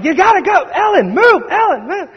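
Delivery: high, loud coaching yell; frantic soccer-coach holler; hollering at the kids. Retold or imitated. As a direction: yell at the kids on the field like a lunatic. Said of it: "I'm hollering and spitting".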